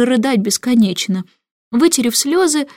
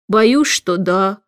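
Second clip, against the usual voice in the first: plain reading voice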